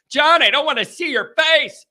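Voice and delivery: high, loud mock complaint; complaining viewer. Viewer telling John off about his face, mock annoyed.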